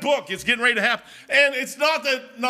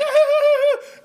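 loud high-pitched laugh; squealed laugh; high squealing laugh; 8 dB louder than usual. Real, not acted. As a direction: burst of high giggle. Laughing at mom running.

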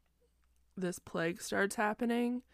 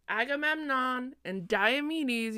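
ordinary delivery

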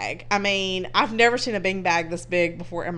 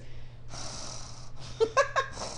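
imitated heavy breathing; 11 dB quieter than usual. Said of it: "that was him breathing".

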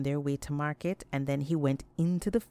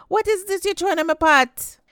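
much higher, loud outraged scream; 14 semitones higher, 10 dB louder than usual. A narrator's reading. Cook screams at Anansi over her boiling pot, outraged shriek. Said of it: "The Omni lady screamed out".

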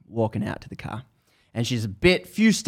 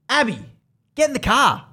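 high-pitched, urgent shout in imitation; mentor calling out; 12 semitones higher, 4 dB louder than usual. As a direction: holler like a mentor hurrying her charge. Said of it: "It's a mentor again".

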